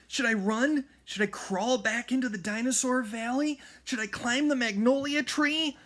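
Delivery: high, loud, racing through options; panicking over what to do; frantic questions. A narrator's reading. Jack panics as T-Rex approaches.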